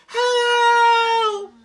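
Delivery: high, loud cry for help; terrified cry. Acted. Sangura falling from the snapped branch, screaming for help. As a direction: scream as you fall.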